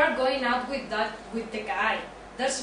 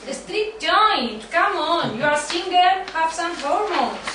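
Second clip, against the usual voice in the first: louder, higher sales pitch to a buddy; pushy excitement; 5 semitones higher, 5 dB louder, slower than usual. Acted. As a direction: pump him up, go for it. Joey urging Ross to go out.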